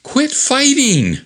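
very high, drawn-out scolding cry; comic-book woman's high-pitched exclamation; exclaiming. Acted. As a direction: exclaim it high, stretching the words. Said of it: "Diane exclaims".

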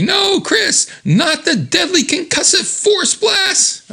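high, drawn-out cry of dread; dramatic mock shout. Pleading with Chris before his blast, high dramatic shout. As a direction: cry out in melodramatic horror, slow and high.

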